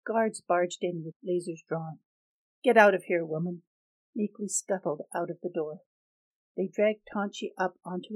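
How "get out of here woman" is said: loud order; barked order. Acted. Guard ordering the woman out of the cell, loud. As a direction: drive her out.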